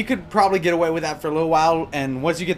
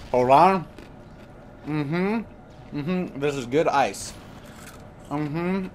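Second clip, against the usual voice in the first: crunching ice between words; chewing ice; 2 semitones lower, 4 dB quieter, much slower than usual. Real, not acted. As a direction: talk while chewing ice. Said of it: "Chewing ice right now".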